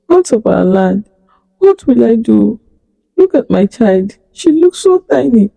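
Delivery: a hint of a grieving mother's voice; lightly voiced mother. Mother staring at her fading child, mildly distraught.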